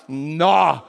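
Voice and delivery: low "nó" at a big payday; impressed Mineiro "nó". Acted. Mineiro seeing a fat paycheck, low impressed "nó". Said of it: "deu muito dinheiro, o que que ele fala".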